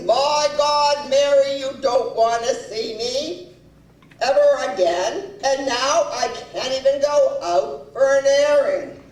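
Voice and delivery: imitated husband's exasperated outburst; acted indignant husband. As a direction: play the husband outraged and put-upon.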